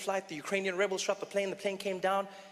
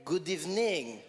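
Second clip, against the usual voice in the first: slow, lower mock-French delivery; French accent; 3 semitones lower, slower than usual. Comedian jokingly greeting the crowd as the French say, French accent. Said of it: "Or as the French say".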